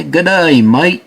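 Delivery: mock Aussie greeting, voice lifted; Australian accent. Host asks if shoes from Australia say this, Australian accent. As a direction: put on a broad Australian accent. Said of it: "we did our Australian accent".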